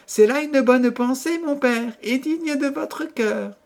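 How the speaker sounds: very high, sweet princess voice; dutiful princess. A narrator's reading. Princess answering her father the king, dutiful. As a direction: answer high, as a devoted daughter.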